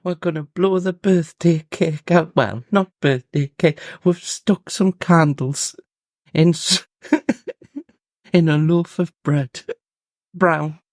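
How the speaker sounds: high, slow mock Geordie-ish mum. Mocking the mum at a birthday with bread, high put-on voice. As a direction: ham up the proud health-food mum.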